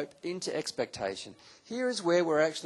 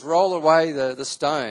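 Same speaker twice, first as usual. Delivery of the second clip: louder, firm order; commanding call; 11 dB louder than usual. Retold or imitated. Jesus orders the stone rolled away, loud command. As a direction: call it out to the crowd.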